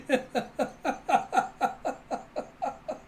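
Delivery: high breathy giggling run; real helpless laughter. Real, not acted. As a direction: lose it completely. Narrator cannot go on.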